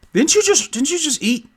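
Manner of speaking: very high-pitched mock disbelief; high, put-on incredulous question; incredulous chiding. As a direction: squeak it out as an acted reproach. Orion chides Denise in story.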